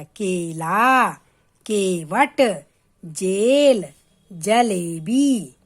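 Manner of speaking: "केला, केवट, जेल, जलेबी" very slow, with a long "ay" vowel; long e demo. Shown as a demonstration. Teacher showing children the e matra in "केला, केवट, जेल, जलेबी", slow stretched vowel. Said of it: "तो ए की मात्रा".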